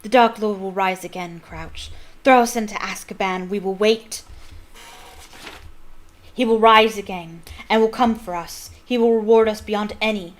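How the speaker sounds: loud, proud fanatic vow; a loyal follower defying the judge; loud, cold declaration; defiant call. Acted.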